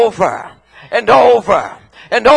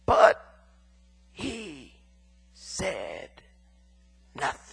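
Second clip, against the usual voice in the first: each word dropped apart, far softer; dramatic pauses; 9 semitones lower, 14 dB quieter, slower than usual. Acted. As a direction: let the silence land between words. Dramatic silence of Jesus.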